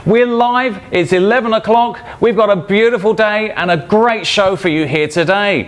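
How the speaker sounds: bright, high-energy TV welcome; upbeat travel show host. Acted. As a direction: open the show like a cheerful host. Live show opening welcome.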